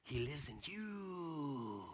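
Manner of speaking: slow, drawn-out hushed call; acted mystical mentor, lingering; awed revelation. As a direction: stretch the last word into a long fading call. Acts climax of Rafiki's line.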